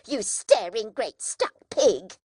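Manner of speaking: loud scornful outburst; furious insult. A narrator's reading. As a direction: hurl the insult at him. Sister insults Joe furiously.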